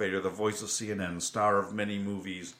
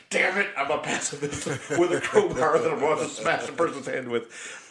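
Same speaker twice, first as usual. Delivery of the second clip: mock exasperated character; 5 semitones higher, 4 dB louder than usual. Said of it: "He's like".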